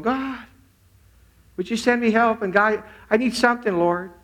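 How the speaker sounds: high, imitated pleading prayer; retold plea. Woman asks God to send help, pleading. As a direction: beg upward as the woman praying for help. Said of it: "where she's going".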